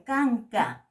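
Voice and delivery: "canca" said loudly with a plain k; plain k model. Shown as a demonstration. Teacher modelling the plain k. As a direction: model "canca" slowly for the class. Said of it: "La K se pronuncia".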